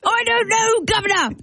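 high, hammed-up English old man; elderly English gentleman. Shown as a demonstration. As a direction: squawk it out as an old English gent. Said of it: "I'm an elderly gentleman".

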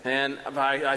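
ordinary delivery

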